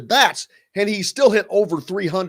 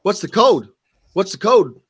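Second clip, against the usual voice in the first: louder mock Pennsylvania accent, "code" for cold; 3 semitones higher, 5 dB louder than usual. Roommate from Pennsylvania mishearing "cold", in a Pennsylvania accent. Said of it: "roommate from Pennsylvania".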